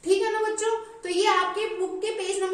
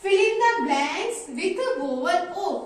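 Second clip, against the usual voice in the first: slow reading aloud; 3 dB louder, much slower than usual. Shown as a demonstration. Teacher to young pupils reading the book's instruction, very slow. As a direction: read the instruction out very slowly, word by word. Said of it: "क्या लिखा हुआ है".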